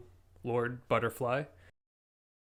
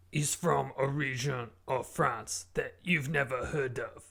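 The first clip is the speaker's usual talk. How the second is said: put-on guest in a bogus French accent; fake French accent; 2 semitones higher than usual. Guest answering the host, in a fake French accent. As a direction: lay on a made-up French accent. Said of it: "doesn't sound like a French accent".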